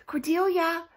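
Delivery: loud calling for a lost dog. A narrator's reading. Owners calling for their lost dog, loud calling. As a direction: call out her name across the porch.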